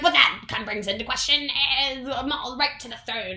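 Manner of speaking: lightly voiced pompous royal. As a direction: object pompously. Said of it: "they're like".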